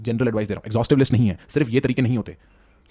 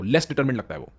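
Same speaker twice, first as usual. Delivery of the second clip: ordinary talk, nothing marked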